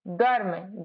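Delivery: usual speaking voice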